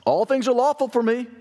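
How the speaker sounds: high-pitched, breezy slogan of the crowd; quoting Corinthians. Retold or imitated. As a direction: voice the audience's saying, pitched well up. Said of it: "there's the quote".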